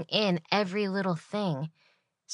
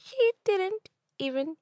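much higher and louder, slow and squealing; incredulous squeal; 5 dB louder, much slower than usual. Acted. To viewers about the coleslaw lady, a disbelieving squeal.

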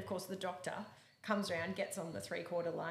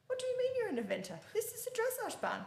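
much higher, fast mock outrage; outraged coach. Coach finds out the rider is an eventer, high-pitched fast outrage. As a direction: squeak it out like a scandalised coach.

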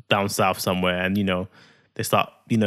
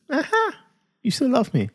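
very high, rising uh-huh; teasing uh-huh; 11 semitones higher than usual. Pretend. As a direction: tease your wife with a squeaky uh-huh. Husband teasing his annoyed wife.

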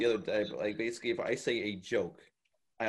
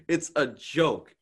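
slow, loud, higher-pitched protest; exasperated; 5 semitones higher, 8 dB louder, much slower than usual. Retold or imitated. Answering being called sexist over a blonde joke, exasperated. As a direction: spell it out, drawn out and exasperated. Acting own exasperated reply.

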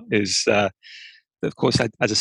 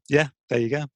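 ordinary talk, nothing marked

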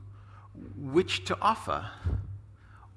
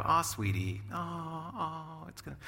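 soothing; 5 dB quieter, slower than usual. Retold or imitated. Father hugging his crying daughter, lightly soothing. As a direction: hush her gently as you hug her. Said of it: "hug her and just go".

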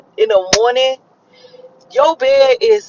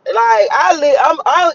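ordinary talk, nothing marked

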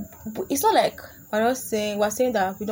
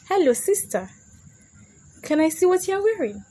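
high, louder mock-sweet request; mock wheedling boy; 9 semitones higher, 3 dB louder than usual. Boy asking a girl what she's wearing, high mock wheedling. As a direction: imitate a pestering boy, pitch climbing high. Mimics boys' creepy request to girls.

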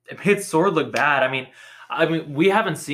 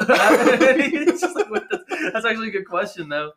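laughing; 7 semitones higher than usual. Real, not acted. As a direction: giggle it out in disbelief. Cracking up retelling scene.

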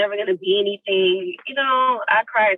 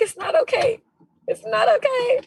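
very high mock wail; mock crying protest. Actress refusing the crew's comfort, mock crying protest. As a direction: wail back at the comforters, imitating tears. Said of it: "I'm still here crying".

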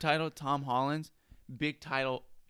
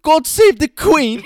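high, loud, slow pompous mock Brit; mock British accent; 10 semitones higher, 9 dB louder, slower than usual. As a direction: proclaim it like a cartoon patriot. British bit.

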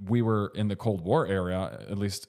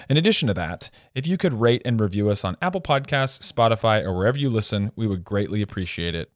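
loud, brisk podcast outro read; promo read; 6 dB louder, faster than usual. Acted. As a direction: rattle off the social media ask.

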